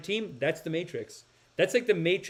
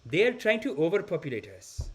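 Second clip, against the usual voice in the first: put-on mild-mannered cousin in an Indian accent; imitated Indian accent, courteous and earnest; polite Indian accent; 3 semitones higher than usual. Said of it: "the most polite guy in the world".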